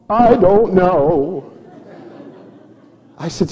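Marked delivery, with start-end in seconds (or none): 0.1-1.5 put-on higher, drawn-out child's whine; slow, whiny kid voice; Chloe. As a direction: imitate a tearful little girl stretching out each word. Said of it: "and she's crying".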